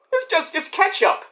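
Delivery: soothing mom. Retold or imitated. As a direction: play it down for the kids. Mother reassuring kids.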